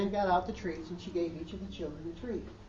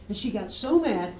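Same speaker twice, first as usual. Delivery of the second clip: usual speaking voice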